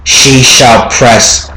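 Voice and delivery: ordinary delivery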